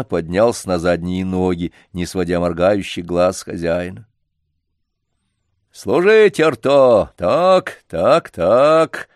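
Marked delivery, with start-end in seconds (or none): 5.7-9.1 put-on old trainer barking commands, high and loud; booming circus ringmaster. A narrator's reading. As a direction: call the dog to sit up like a showman. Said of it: "проговорил старик".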